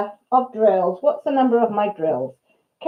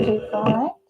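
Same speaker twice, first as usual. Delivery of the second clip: ordinary talk, nothing marked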